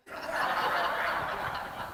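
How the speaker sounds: soft breathy laugh at himself; a hushed chuckle at his own folly; quiet laugh. Real, not acted.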